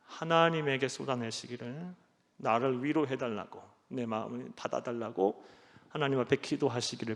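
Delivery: ordinary delivery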